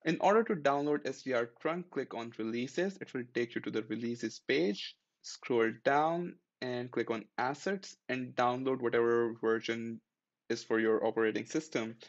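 ordinary talk, nothing marked

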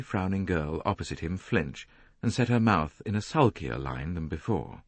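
ordinary delivery

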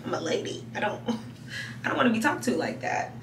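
ordinary talk

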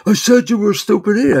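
hammed-up impatient heckler repeating his insult; mock irritated heckler. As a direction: play the exasperated guy who insulted you. Mocking the insulter repeating himself.

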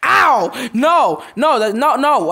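loud, high panicked protests; panicked no's. Real, not acted. Gamer panicking as he gets hit. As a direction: protest in a fluster.

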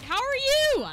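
high, chirped question; over-cheery greeting. Real, not acted. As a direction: greet them with exaggerated cheer.

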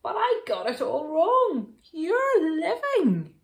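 loud, high, thrilled outburst; excited storybook bear; crying out. A narrator's reading. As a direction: exclaim it with joyful amazement. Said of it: "cried the bear".

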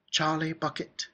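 put-on high, timid, hushed child; small, nervous voice. A narrator's reading. Last child giving his name at the gates, small and nervous. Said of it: "a small, nervous voice whispered".

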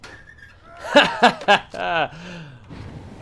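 loud villainous cackle; evil laugh. Acted. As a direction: laugh like a gleeful killer.

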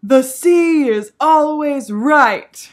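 slow, high, loud shouted chant; mock scream. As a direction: bellow it slowly at the sea.

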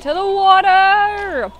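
loud, high, long-drawn charging yell; stretched-out battle cry; rallying cry. Real, not acted. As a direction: build up and holler the last word long. Calling out a charge in game.

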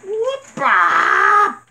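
very high, loud yelling whoop; screaming. Real, not acted. Gamer screaming his head off at the hunt, high yell. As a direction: let out a wild yell. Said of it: "me just screaming my head off".

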